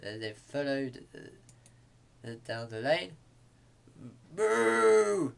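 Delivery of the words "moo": loud, high, long moo; cow's moo. A narrator's reading. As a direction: bellow a moo. Cow chases farmer away.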